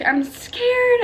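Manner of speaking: very high, small frightened voice, retold; scared. Mother in labor as the pushing is about to start, scared high voice. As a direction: squeak out the fear in a high plea. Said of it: "And I'm gonna cry".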